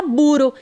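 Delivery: "buro" com um R só, de toque leve; enfraqueça o R, saindo "buro"; R brando errado. Shown as a demonstration. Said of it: "Se eu colocar um R só". (erre fraco em "buro")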